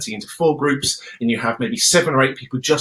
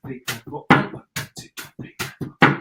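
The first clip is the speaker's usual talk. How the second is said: counting in time; 6 semitones higher than usual. Shown as a demonstration. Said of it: "It's gonna sound like this".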